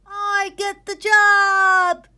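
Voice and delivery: very high, very loud, slow crowing; triumphant kid crowing; crowed. A narrator's reading. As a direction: crow it in triumph. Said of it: "crowed Gregory".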